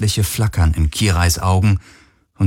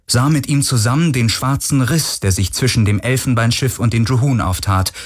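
ordinary delivery

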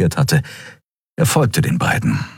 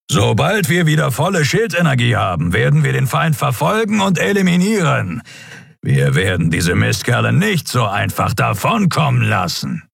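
higher, emphatic captain's voice; rally the crew with resolve; captain addressing crew; 7 semitones higher than usual. A narrator's reading. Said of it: "fuhr, seiner Mannschaft zugewandt". (entschlossene Ansprache an die Mannschaft)

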